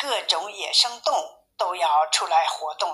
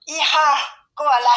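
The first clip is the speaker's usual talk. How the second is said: high, raised summoning call; storybook mother calling, pitched up; 7 semitones higher, 4 dB louder than usual. A narrator's reading. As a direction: call a cub over. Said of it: "叫道".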